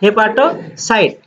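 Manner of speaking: "Hepatocyte" said slowly, syllable by syllable, as a drill. Shown as a demonstration. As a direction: stretch out "hepatocyte" so the class can repeat it. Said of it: "এটাকে বলা হয় কি?". (ধীরে ধীরে স্পষ্ট উচ্চারণ)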